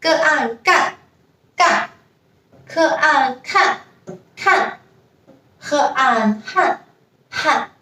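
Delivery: gan, kan, han said high and clear; syllable drill. Shown as a demonstration. Teacher drilling an syllables. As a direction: spell out each initial and final then blend. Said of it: "Next one".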